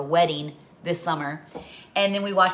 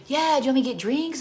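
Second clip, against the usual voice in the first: put-on higher eager child's voice; kid voice; 7 semitones higher, faster than usual. Kids offering to get drinks, voiced higher and eager.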